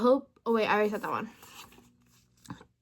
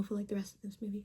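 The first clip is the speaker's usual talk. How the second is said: whispered aside; 12 dB quieter, faster than usual. Real, not acted. Aside about being emo.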